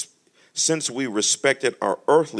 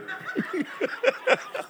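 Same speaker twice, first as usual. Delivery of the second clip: high laugh. Real, not acted. Laugh after joke.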